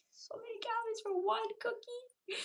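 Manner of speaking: high whiny wail over the cookie; a hammed-up high whimper of dismay; mock whine. Retold shock at calories.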